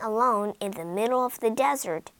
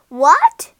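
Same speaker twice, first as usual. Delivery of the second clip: loud, higher outraged cry; shocked shout; 5 semitones higher, 10 dB louder, much slower than usual. Acted. Witch shouting at Rapunzel on hearing of the prince, loud. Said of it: "shouted the witch".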